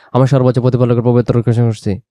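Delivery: fast, swallowed আমার সর্বোচ্চ প্রতিপালকের; rushed and slurred. Shown as a demonstration. Teacher showing how hurried recitation garbles meaning. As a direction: gabble it so words run together. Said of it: "তাড়াহুড়ো করে বলবেন".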